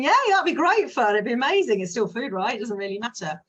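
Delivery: high, loud burst of delight; gleeful. Acted. Carefree dog person thrilled by mystery takeaway order, gleeful cheer. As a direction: cheer like a happy puppy.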